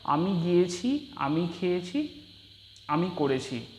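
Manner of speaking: slow deliberate demo of গিয়েছি, খেয়েছি, করেছি, each ending said clearly; slow, careful demo. Shown as a demonstration. Teacher to Bengali students showing first-person verb forms, slow and clear. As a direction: spell out each verb ending slowly for the class. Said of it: "আমি কীভাবে বলি জিনিসটা".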